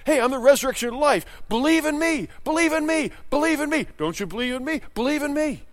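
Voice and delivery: high, pressing plea, repeated insistently; Jesus urging the crowd to believe in him; Jesus pleading. Acted. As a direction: beg them over and over to believe. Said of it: "he says".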